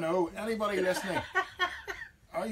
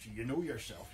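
lower, quieter knowing "So you know yourself"; knowing eye-roll; 6 semitones lower, 4 dB quieter than usual. Retold or imitated. Answering a melter you can't hear, with a knowing eye-roll. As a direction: say it like a vague knowing nod. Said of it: "you roll your eyes and go".

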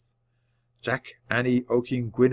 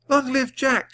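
mild shout; 13 semitones higher than usual. A narrator's reading. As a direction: cheer it high, keeping the volume in check. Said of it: "shouted Annie".

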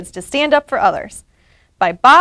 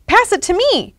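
storybook yell; 9 semitones higher than usual. A narrator's reading. Pete to players on the field, high mild yell. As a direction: call out for the ball, pitched up. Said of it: "ran over to the field and yelled".

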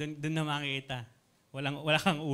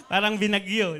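laughing; 4 semitones higher, 7 dB louder than usual. Real, not acted. Laughs at the messy bedroom.

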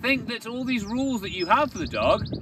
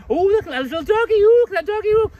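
high, fast, louder cooing; gushing baby talk; 13 semitones higher, 7 dB louder, faster than usual. Retold or imitated. Owner squealing baby talk at a passing dog. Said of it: "Your dog's gonna pick up on you going".

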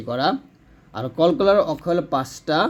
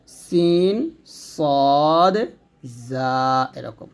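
ordinary delivery

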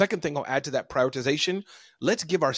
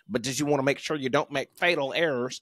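Southern drawl; 4 semitones lower than usual. Retold or imitated. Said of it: "as Dave Ramsey says in his Southern drawl".

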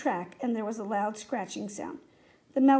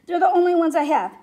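loud, higher desperate plea; distressed, raised-voice begging; 5 semitones higher, 9 dB louder than usual. A narrator's reading. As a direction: plead as a last appeal. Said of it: "Tom begged".

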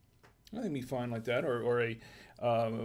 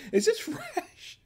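laughing question; much slower than usual. Real, not acted. Laughing at suspicious moldy cheese.

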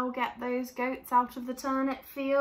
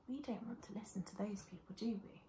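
whisper; 13 dB quieter, faster than usual. Real, not acted. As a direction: share a secret softly and quickly. Hushed confiding to children.

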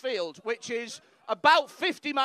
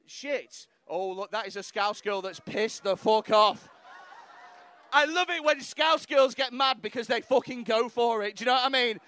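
ordinary delivery

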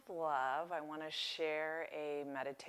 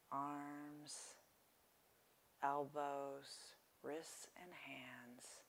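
softly paced phrases, leaving space to breathe; guided meditation; 6 dB quieter than usual. Real, not acted. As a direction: guide listeners gently into relaxation, unhurried.

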